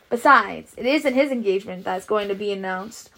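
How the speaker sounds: understated triumph, punching the opening word; mild triumph. A narrator's reading. Stuart revealing whose engagement it is, mildly triumphant. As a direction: lightly crow over having the real news. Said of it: "said Stuart triumphantly".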